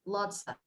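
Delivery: "lots of" quick and soft, "of" cut to "a"; reduced. Shown as a demonstration. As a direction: say "lots of" as "lotsa". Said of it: "we say".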